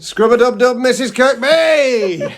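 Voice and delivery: high, loud, playful holler; rowdy shout-out. Pretend. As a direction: holler a silly bath-time cheer, drawing out the name. Playful shout-out to mother in bath.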